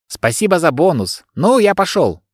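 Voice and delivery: rising delight, pleased with tip; cheerful. A narrator's reading. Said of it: "радостно произнёс водитель". (радостно, повыше)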